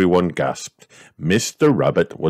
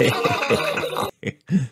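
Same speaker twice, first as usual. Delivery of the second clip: airy high giggle; light laugh; 10 semitones higher than usual. A narrator's reading. Everyone laughs at George's roar, airy giggle. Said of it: "Everyone laughed".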